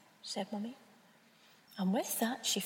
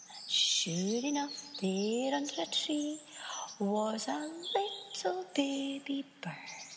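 ordinary delivery, nothing marked

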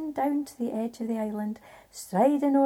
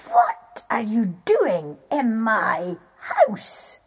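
slow, loud booming giant; giant's voice; 9 dB louder, much slower than usual. A narrator's reading. Giant challenging Jack in his house, slow and booming. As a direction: boom it out slowly as the giant. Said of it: "He said".

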